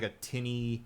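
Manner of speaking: ordinary talk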